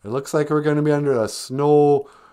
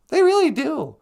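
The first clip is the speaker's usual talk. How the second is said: very high, louder squeaky agreement; 4 dB louder than usual. Acted. The sidekick squeaks agreement with the host about snowplows. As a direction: chirp in like a puppet.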